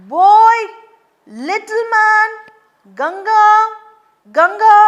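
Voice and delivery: loud high calling out. Acted. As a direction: call across the water for the boy. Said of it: "Mara howling".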